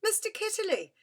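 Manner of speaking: put-on girl shouting out a sudden memory. A narrator's reading. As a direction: shout the name as it suddenly comes back. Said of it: "she shouted out".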